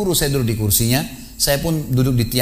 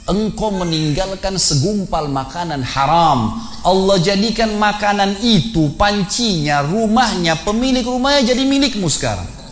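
rising solemn proclamation; 7 semitones higher, slower than usual. Acted. Istri berkata dengan iman.

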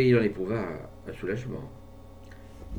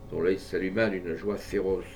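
ordinary delivery, nothing marked

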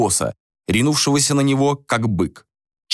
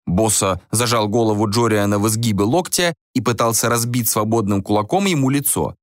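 ordinary delivery

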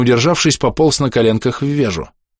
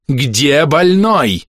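very drawn-out, higher-pitched shout; крик; 6 semitones higher, 3 dB louder, much slower than usual. A narrator's reading. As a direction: demand it slowly, syllable by syllable. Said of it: "закричал доктор". (протяжный требовательный крик)

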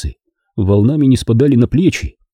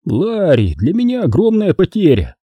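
higher, icy mock congratulation; sneering boss; 9 semitones higher than usual. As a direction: congratulate him with cold contempt. Vaughan's line.

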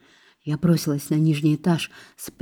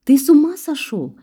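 high, louder burst of disbelief; acted squeal, pitch leaping up; shocked shriek; 9 semitones higher, 5 dB louder than usual. A narrator's reading. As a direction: shriek it in alarm. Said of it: "взвизгнула я".

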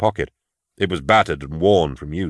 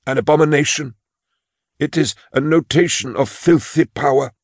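put-on high, timid old clerk voice; a frail old clerk confessing his fear; old man Imus; 8 semitones higher than usual. Imus describes number of ruin.

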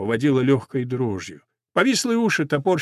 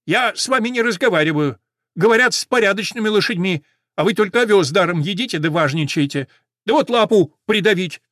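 offended beetle, higher voice; huffy beetle; 6 semitones higher, 4 dB louder than usual. A narrator's reading. Beetle snubs Мэри for stepping on a paw, huffy. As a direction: grumble loftily in a raised, offended voice.

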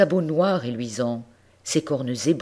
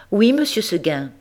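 higher, louder goat reply; a hint of a stubborn young goat; mild goat voice; 6 semitones higher, 5 dB louder than usual. A narrator's reading. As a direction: answer sweetly but firmly. Goat answers her master.

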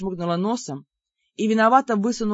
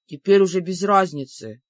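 ordinary delivery, nothing marked

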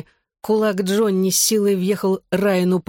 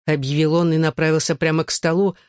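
ordinary delivery

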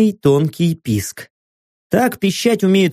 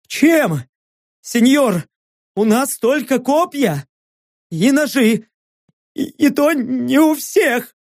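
panicked pleading; 8 semitones higher than usual. Acted. Panicked goblin act continues.

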